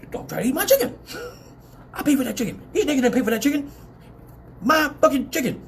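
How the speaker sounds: high-pitched plaintive protest; aggrieved complaining. Retold or imitated. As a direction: whine as the wronged chicken owner. Stinger sulks over his chicken.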